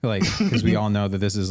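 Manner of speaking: ordinary talk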